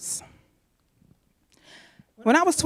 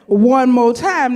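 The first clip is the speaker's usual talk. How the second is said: louder, slow, drawn out call; 3 semitones higher, 7 dB louder, much slower than usual. Retold or imitated. Third call from the man at rehearsal, louder and slow. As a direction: stretch it out loud. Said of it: "I hear this voice say".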